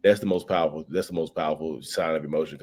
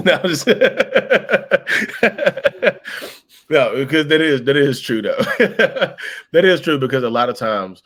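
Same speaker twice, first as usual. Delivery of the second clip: louder, higher talk through laughter; laughing while talking; 9 semitones higher, 9 dB louder than usual. Real, not acted. After teasing Lisa, speaking loud and high through real laughter. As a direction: keep laughing as you speak. Laughing at her own joke.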